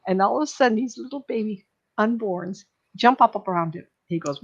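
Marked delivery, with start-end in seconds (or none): none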